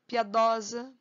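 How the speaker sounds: normal talking voice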